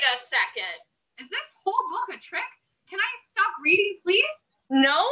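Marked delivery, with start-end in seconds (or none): 4.7-5.0 a loud flat refusal. Acted. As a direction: shout it like a bossy book. Book answers reader's plea.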